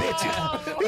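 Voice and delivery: ordinary delivery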